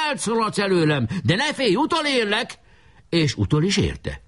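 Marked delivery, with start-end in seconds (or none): 0.1-2.6 high taunting cry; shouting. A narrator's reading. Chaser warns the fleeing leader, high shout. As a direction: threaten in a very high call. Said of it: "kiáltott rá".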